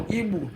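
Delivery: "Igbo" said as ee-boo; mispronounced Igbo. Shown as a demonstration. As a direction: end "Igbo" on an oo sound. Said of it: "No, not Igbo".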